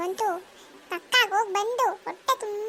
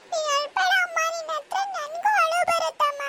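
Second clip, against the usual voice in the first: higher, pleading on the edge of tears; tearful child; 6 semitones higher than usual. Acted. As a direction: beg her mother not to cry. Daughter near tears. (ಅಳುವಿನ ಅಂಚಿನ ಮಗು)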